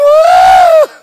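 very high, very loud whoops, almost crying; joyful church shout. Retold or imitated. Preacher imitating the woman whooping in his office. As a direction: let the whoops fly in holy joy. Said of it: "She shouted in the office".

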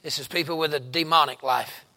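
ordinary talk

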